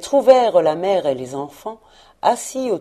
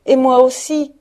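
high, loud exclamation; eager cry; 7 semitones higher, 7 dB louder than usual. A narrator's reading. Another child chiming in after his brother, crying out. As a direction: cry out keenly to be counted in. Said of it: "s'écria un autre".

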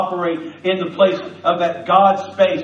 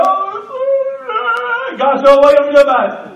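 mocking whine; 11 semitones higher, 4 dB louder than usual. Mock whining prayer.

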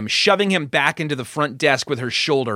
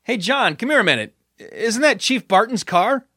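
higher-pitched call to a colleague; 9 semitones higher than usual. A narrator's reading. Officer calls John over to look outside, calling out. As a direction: call him over, voice lifted. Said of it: "he called out".

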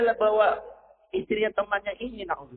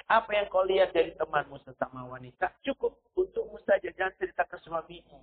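ordinary talk

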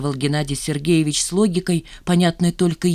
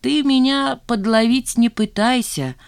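slow, higher, weary dismissal; sighing; 7 semitones higher, slower than usual. A narrator's reading. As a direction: slowly brush off the trick question. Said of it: "вздохнул Геннадий Сергеевич". (со вздохом, протяжно)

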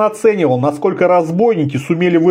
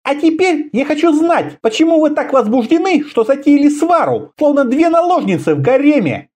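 high, imperious female voice; scolding woman leader; 11 semitones higher than usual. A narrator's reading. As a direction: demand an explanation, pitched high. (властно отчитывает высоким женским голосом)

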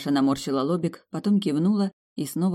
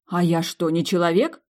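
plain reading voice

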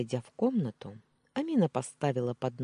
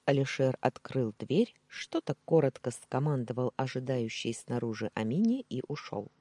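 ordinary delivery

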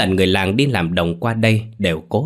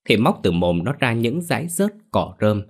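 usual speaking voice

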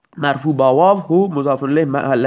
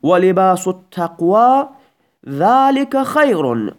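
slow recited example; 2 semitones higher, slower than usual. Shown as a demonstration. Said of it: "مثال دوم".

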